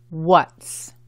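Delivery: "what's" as one syllable ending in TS. Shown as a demonstration. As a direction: model "what's" for repeating, a single syllable. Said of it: "es una sola sílaba".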